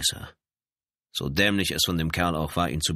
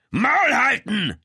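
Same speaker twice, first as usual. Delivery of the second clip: loud, high, drawn-out roar; bellowed command; 7 dB louder, slower than usual. A narrator's reading. Lieutenant blocks the raging man's path, bellowing. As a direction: bellow to shut him up. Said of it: "brüllte er".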